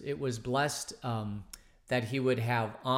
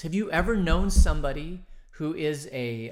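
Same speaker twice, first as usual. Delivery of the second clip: normal talking voice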